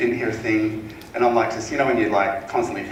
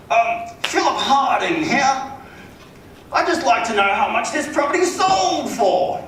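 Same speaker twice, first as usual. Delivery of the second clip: high, drawn-out wealthy person's affected voice; Philip Harding, wealthy caller; 12 semitones higher, 4 dB louder, slower than usual. Retold or imitated. Wealthy homeowner phones the agent to ask a price, affected and drawn out. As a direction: put on a rich man's slow, high-pitched phone manner. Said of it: "wealthy people, like, they just have this".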